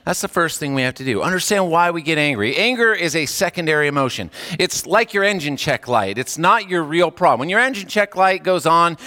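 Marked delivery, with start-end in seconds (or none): none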